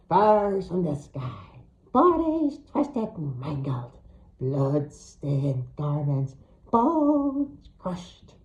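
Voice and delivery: slow eerie crone voice, half whispered; creepy witch babbling doom; hag's prophecy. Acted. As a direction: chant it like a seer in a trance.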